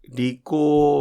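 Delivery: very slow, careful teacher model of 利口; slow model word. Shown as a demonstration. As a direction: spell out 利口 in three slow beats. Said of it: "文字が三つですから".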